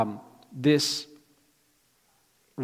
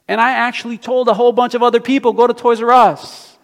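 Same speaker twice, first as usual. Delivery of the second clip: excited shout; 10 semitones higher, 11 dB louder than usual. Retold or imitated. Telling others about sale.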